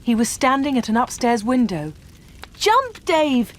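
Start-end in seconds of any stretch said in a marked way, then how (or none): 2.6-3.5 high, loud urgent call; shouting. Acted. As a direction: yell up to the burning window. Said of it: "they shouted".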